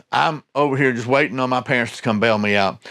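usual speaking voice

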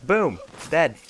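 gleeful kill shout; triumphant shout. Real, not acted. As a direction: punch it out like a victory cry. Game kill, excited outburst.

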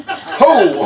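high, loud delighted crow. A narrator's reading. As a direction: crow with glee. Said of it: "Harmony crowed".

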